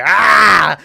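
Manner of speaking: short loud mock yell; hammed-up killer doll shout; mock Chucky scream. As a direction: burst out with a high yell. Chucky busts out of the box.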